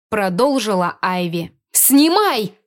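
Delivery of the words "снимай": high, loud snapped command; barked order. Acted. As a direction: bark it as one sharp order. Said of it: "хором рявкнули".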